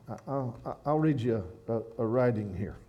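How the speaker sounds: normal talking voice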